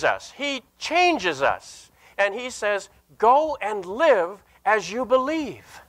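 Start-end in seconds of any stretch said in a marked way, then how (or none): none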